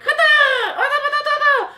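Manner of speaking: very high laughing fake fighting yells; mock samurai battle cries. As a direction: ham up gibberish sword shouts. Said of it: "all the guys were like".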